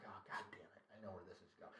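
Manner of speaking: hushed unvoiced aside; whispered dread as the boss approaches. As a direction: whisper it so the office can't hear. Inner thought in cubicle.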